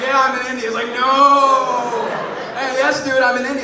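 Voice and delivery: loud insistent back-and-forth; insisting. Retold or imitated. Customer insists to cheesesteak cashier he is in India, loudly.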